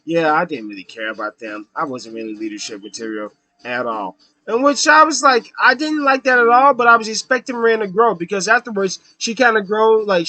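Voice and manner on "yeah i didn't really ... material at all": much lower, much softer, offhand Rin; mumbled confession. Retold or imitated. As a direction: drop the voice low and admit it limply. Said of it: "when she acknowledges".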